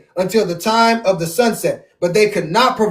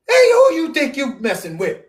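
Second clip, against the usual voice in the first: mock tough guy; 4 semitones higher than usual. Powerful man taunting his enemy.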